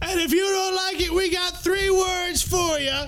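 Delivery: high, far-off booming announcer voice; ringmaster. Acted. Joins the announcement in a raised voice.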